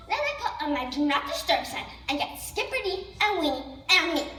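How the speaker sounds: ordinary delivery, nothing marked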